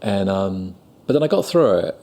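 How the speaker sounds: usual speaking voice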